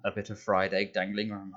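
ordinary delivery

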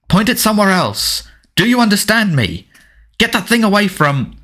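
high, urgent exchange; panicky standoff. A narrator's reading. Cousins arguing over a pointed wand, panicky and heated. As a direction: pitch shoots up as the confrontation peaks. Frightened quarrel at wandpoint, escalating.

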